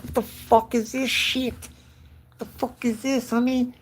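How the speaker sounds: high, strained, whining protest; struggling under weight. Acted. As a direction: complain while barely holding up a heavy load. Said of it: "struggling to fucking hold that shit up".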